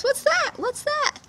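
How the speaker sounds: very high, loud repeated question; insistent pointing. Acted. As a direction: push her to look.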